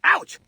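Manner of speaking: short, high-pitched ouch; pained yelp. Acted. Man hurt by an unknown arrival, pained yelp. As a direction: yelp at a sudden knock.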